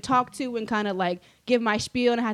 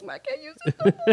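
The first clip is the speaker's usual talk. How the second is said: laughing mock lament. Opening presents after failing.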